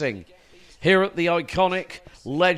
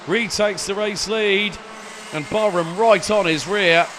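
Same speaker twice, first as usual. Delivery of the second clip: excited, raised race play-by-play; motorsport commentator; 3 semitones higher, 3 dB louder than usual. Acted. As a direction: call the race live and keen. Live race play-by-play, pre-final start.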